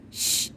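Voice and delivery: unvoiced "shh" for silence; a soft hush to the listeners; shushing. Hush acted in the story.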